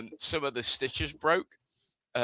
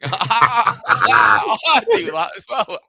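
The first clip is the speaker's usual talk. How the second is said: laughing shout; 5 semitones higher, 10 dB louder, slower than usual. Real, not acted. Laughing at roasties joke.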